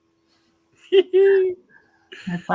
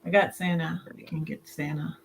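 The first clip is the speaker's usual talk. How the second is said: from another room; 3 semitones lower, 9 dB quieter than usual. Real, not acted. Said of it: "We can hear you".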